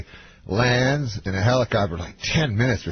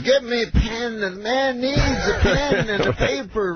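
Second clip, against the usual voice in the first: high, very slow, halting mimicry; Bill Cosby; 11 semitones higher than usual. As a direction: stretch each word in a high impression. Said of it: "so he goes".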